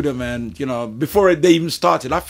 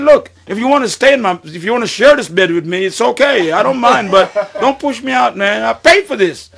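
mock bargaining; 8 semitones higher, 7 dB louder than usual. Bargaining with the ghost.

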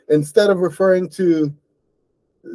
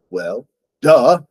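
louder sarcastic "duh"; sarcastic dog's retort; snarky reply; 3 semitones lower, 5 dB louder than usual. A narrator's reading. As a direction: toss off a cheeky "Well, duh".

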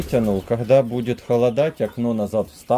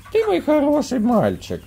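very high squeaky praise; squeaky praising a dog. Real, not acted. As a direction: praise the pup in falsetto.

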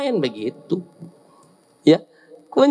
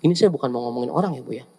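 quick gossip disclaimer; 11 semitones lower, 4 dB quieter, much faster than usual. Acted.

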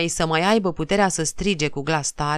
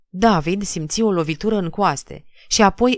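ordinary delivery

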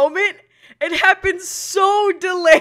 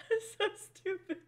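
soft, high laughing squeak; crying with laughter; 6 semitones higher, 9 dB quieter than usual. Real, not acted. Streamer laughing at own stupidity, weak tearful voice. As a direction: squeeze it out through tearful laughter. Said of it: "I'm crying".

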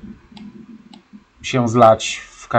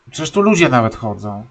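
ordinary talk, nothing marked

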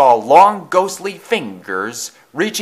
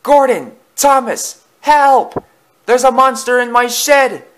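panicked wail, very high and loud; Percy; 12 semitones higher, 6 dB louder than usual. A narrator's reading. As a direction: cry out for help in alarm. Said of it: "wailed Percy".

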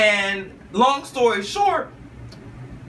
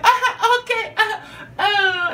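high giggly uh-huh, much higher; laughing; slower than usual. Retold or imitated. Dodging the dance with a high nervous laugh. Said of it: "so I'm like".

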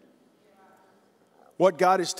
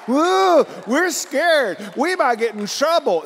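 mock scared; 9 semitones higher, 6 dB louder, slower than usual. Mocking timid believers.

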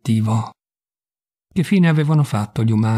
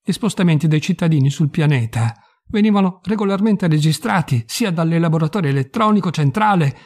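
plain reading voice

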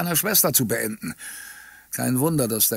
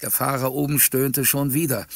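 ordinary delivery, nothing marked

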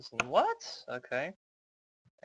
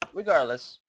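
ordinary delivery

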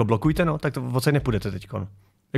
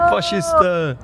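very high, squeaky jeer; mock heckler cry; 4 dB louder, much slower than usual. Parodies heckler from played clip.

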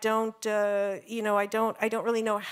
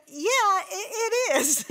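laughing agreement; 9 semitones higher, 4 dB louder, much slower than usual. Retold or imitated. Laughing reply to Christ-haunted.

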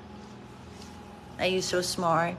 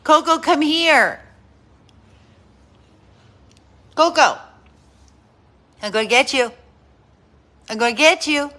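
higher, louder playful calls; calling the dog; 7 semitones higher, 10 dB louder, faster than usual. Real, not acted. Owner calling her dog back during play. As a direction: call her in for a chase.

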